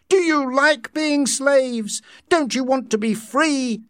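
high, loud impassioned challenge; shouting. A narrator's reading. Savage to soma crowd, defiant shout. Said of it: "shouted the savage".

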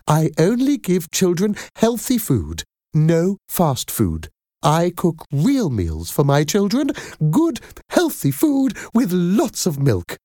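high-pitched, effusive character voice with little giggles; gushing friendly nanny. Acted. Nanny selling her cooking to a mother, gushing and friendly. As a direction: chatter on enthusiastically, pitch soaring.

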